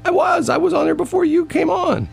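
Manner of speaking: high, whiny mock complaint; squeaky wounded protest; mock whine. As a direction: whine it like a hurt kid. Childish insistence in argument.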